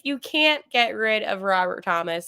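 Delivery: normal talking voice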